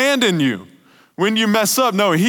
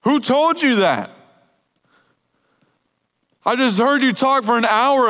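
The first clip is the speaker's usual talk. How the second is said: forceful challenge; 4 semitones higher than usual. Real, not acted. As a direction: fire the question back hard. Preacher challenges the lie.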